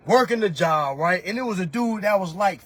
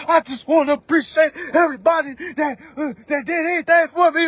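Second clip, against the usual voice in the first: mock thank-you post; 4 semitones higher, 5 dB louder than usual. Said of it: "I mean".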